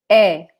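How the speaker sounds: é said as an open eh vowel, like in "café"; Portuguese é with accent. Shown as a demonstration. Teacher demonstrating the accented verb é to viewers, open vowel. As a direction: open the mouth wide on é, an open "eh". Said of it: "we say".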